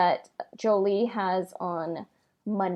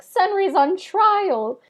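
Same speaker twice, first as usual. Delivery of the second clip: high, slow, louder mock announcement; imitating Elora; 11 semitones higher, 5 dB louder, slower than usual. Elora greeting the player, high slow mock drama. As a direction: pitch it way up and drag it out like a dramatic NPC. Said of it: "Elora comes up to you and says".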